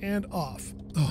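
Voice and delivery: ordinary talk, nothing marked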